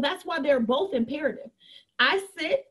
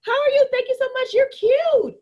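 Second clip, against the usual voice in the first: gushing greeting; 10 semitones higher, 5 dB louder than usual. Retold or imitated. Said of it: "I'll go in your inbox and be like".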